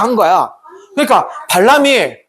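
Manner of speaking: ordinary talk, nothing marked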